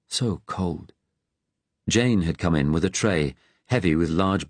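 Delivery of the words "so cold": a quiet, sorrowful echo; softly echoed with feeling. A narrator's reading. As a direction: repeat it softer, trailing off in pity. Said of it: "with feeling".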